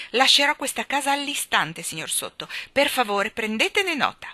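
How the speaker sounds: loud, high-pitched outraged scolding; indignant anger. A narrator's reading. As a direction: tell them off with offended dignity. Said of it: "Piena di collera". (indignazione acuta e collerica)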